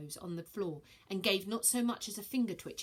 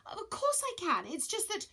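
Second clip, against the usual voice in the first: flustered; 13 semitones higher than usual. Acted. Virgo flustered high.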